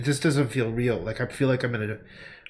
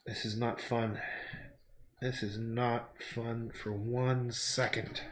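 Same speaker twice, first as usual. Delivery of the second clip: quiet; 9 dB quieter, slower than usual. Retold or imitated. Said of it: "And I'm like".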